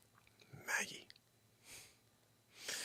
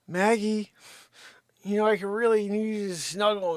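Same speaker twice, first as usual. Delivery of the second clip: squeaky, much higher sweet talk to the dog; high baby voice for the dog; 8 semitones higher, 5 dB louder than usual. Acted. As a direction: coo at the dog like she's a baby.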